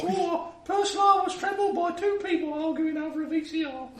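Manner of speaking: very high piping Cockney voice; small Cockney child. Acted. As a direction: chatter like a little Cockney urchin. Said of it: "him to be a small Cockney child".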